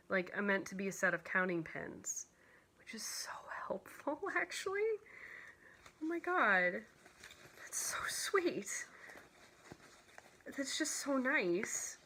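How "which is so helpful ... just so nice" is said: breathless whisper rising into a tearful voice; deeply touched, voice giving way; near tears. Real, not acted. Crafter moved by handmade counting pins, near tears. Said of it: "I'm probably gonna cry".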